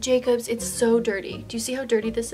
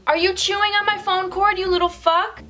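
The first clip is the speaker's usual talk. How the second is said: high, loud scolding; scolding dog; 8 semitones higher, 5 dB louder than usual. Real, not acted. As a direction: tell the dog off.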